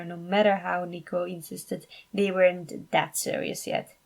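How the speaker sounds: ordinary delivery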